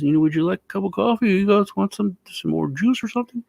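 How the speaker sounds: high, quick host offering drinks; imitating hospitable grandpa. Grandpa offers the boys coffee, high quick old voice. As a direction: fuss over guests like an old host. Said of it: "He says".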